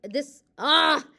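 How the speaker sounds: sharp frustrated cry at a fumble; loud frustrated ah; frustrated yelp. Real, not acted. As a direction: yelp of annoyance at tripping over the word. Stumbles over word, frustrated.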